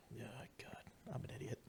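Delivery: whispered self-scolding. Real, not acted. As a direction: whisper it under your breath.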